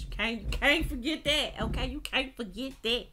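lightly put-on sassy voice; a hint of a mock girly voice about lip gloss. Reminding viewers about lip gloss, lightly put-on voice. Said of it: "I don't know nobody that talk like that".

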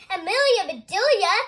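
high, loud exasperated yell. A narrator's reading. Mister Rogers calls out to Amelia Bedelia on a hot day, yelling. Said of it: "yelled Mister Rogers".

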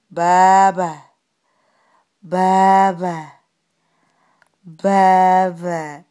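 long, loud stretched syllables with a falling end; drawn-out coaxing. Real, not acted. Mother prompting her baby to say bye-bye, drawn-out coaxing.

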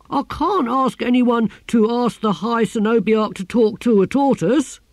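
nervous disbelief; the gardener balking at the order; panicked gardener. A narrator's reading.

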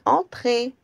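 high little voice calling out; small voice. Acted. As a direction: call in a tiny high voice. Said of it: "Une petite voix dit".

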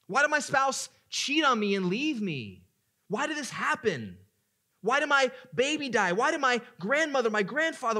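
loud anguished cry, pitch high. Acted. Preacher voicing a sufferer crying out to God, loud anguish. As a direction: shout your questions up to God. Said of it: "Cry out".

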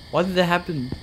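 high, startled, uneasy question; jumpy alarm at the sudden dark. Real, not acted. Streamer spooked by the darkness, alarmed high question. Lights suddenly go darker in game.

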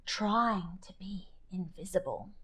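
soft secret whisper; hush, we're hiding. A narrator's reading. Prowling, trying to be invisible.

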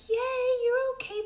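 high mock-cheer voice; high-pitched pretend celebration; clapping cheery parent. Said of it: "don't clap and be like".

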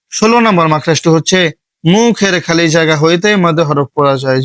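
normal talking voice

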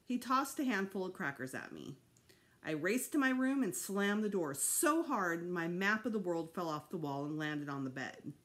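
ordinary delivery

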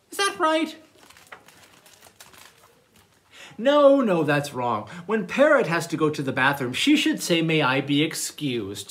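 animated storytime narration with big swings in pitch; reading to children. Acted. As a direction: read a playful picture book to little listeners. Reads manners story to children.

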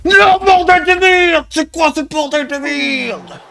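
furious gamer shout, slowed; yelling at the game in rage; raging gamer. Real, not acted. Losing the game, swearing.

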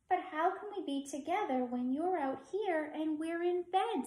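a hint of a high child's voice; lightly voiced little girl. A narrator's reading. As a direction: ask it like a puzzled little girl. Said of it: "said Liza".

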